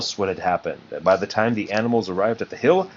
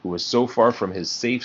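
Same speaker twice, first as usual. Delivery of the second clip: ordinary delivery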